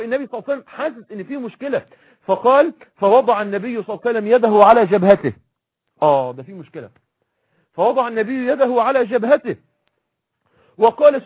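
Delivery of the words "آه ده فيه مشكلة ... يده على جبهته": ordinary talk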